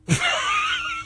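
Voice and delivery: very high, soft giggle; high-pitched laughing at their expense; scornful laugh. Real, not acted. Radio host scoffing at networks, scornful high giggle.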